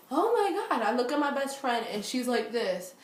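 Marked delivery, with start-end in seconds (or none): 0.1-0.8 high startled outburst; shocked oh my God. Retold or imitated. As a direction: blurt it out in sudden alarm, high. Drink suddenly kicks in.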